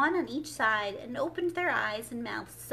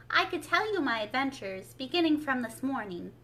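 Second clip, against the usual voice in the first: ordinary delivery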